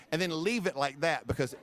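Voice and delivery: usual speaking voice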